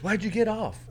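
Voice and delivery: high, puzzled question; quizzical boss. Retold or imitated. Organizer asking the comic why he left, high puzzled voice. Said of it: "the guy goes".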